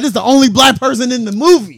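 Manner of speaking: high, loud, laughing disbelief; comic outrage. Retold or imitated. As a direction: protest it loudly through laughter. Retold outrage at the movie.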